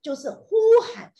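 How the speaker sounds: a sudden high, loud burst on the word; a loud exclamation; loud exclaim. Real, not acted. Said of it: "我们要学习一件事情，shout".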